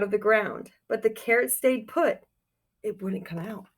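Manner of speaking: put-on reading a picture book aloud to children; storybook reading. A narrator's reading. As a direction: tell it like storytime for kids.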